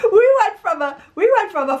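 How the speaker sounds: high, laughing and almost crying. Real, not acted. Telling viewers about losing the house, laughing. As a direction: laugh through it.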